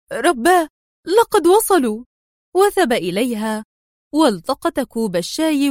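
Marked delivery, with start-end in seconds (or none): 0.1-2.0 high, alarmed exclamation; panicked cry. A narrator's reading. Woman hearing the searchers arrive, panicked. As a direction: cry out in sudden terror, pitch leaping. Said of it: "انتفض جسدها في ذعر وهي تهتف".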